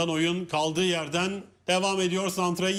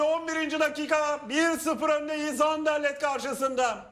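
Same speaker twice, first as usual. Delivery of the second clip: screaming football commentator; 7 semitones higher than usual. Real, not acted. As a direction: ride the joy of the goal. Goal celebration commentary.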